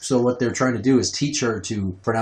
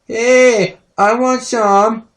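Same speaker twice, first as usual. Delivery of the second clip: put-on high, loud, drawn-out kid voice; pushy little-kid demand; begging toddler; 11 semitones higher, 6 dB louder, much slower than usual. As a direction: wheedle for food like a small child. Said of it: "And she's all".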